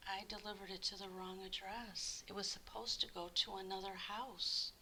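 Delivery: quiet whispered confession; guilty confession. Acted. Postman admitting the wrong address, hushed and guilty. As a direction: admit it under your breath.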